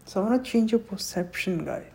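ordinary talk

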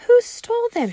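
high, loud concerned question; teacher's question. Acted. Teacher questioning Junie B., high concerned ask. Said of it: "she asked".

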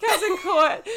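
high laughing echo. Real, not acted. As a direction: laugh the names out. Laughing repeat of names.

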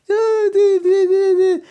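very high loud squeaky yip; dog squeak. Retold or imitated. The dog answering the wife, shrill high squeaks. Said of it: "le contesta la perra en ese tono".

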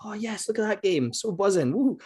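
put-on high, gleeful kid excitement; excited. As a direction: squeal over the present. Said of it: "I was like".

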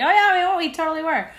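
high, loud, exaggerated oh-yeah-we-were; mock sudden realization. Teasing partner about the recording, mock sudden remembering. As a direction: play it like you just remembered, hammed-up and high. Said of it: "And then I was like".

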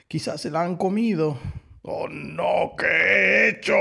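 high alarm building to loud wail; anguished howl. A narrator's reading. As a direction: rise from worry into a howled regret. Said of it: "aulló el lobo". (aullido de angustia)

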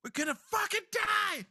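high panicked yell, hammed up; mock panic. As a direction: cry out in pretend doom, voice climbing. Player acts panicked party member.

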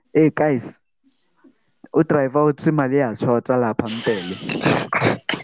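a Zimbabwean passenger's Zulu, lightly voiced; Zimbabwean accent. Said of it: "I'm making a Zimbabwean accent".

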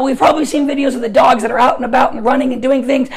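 normal talking voice

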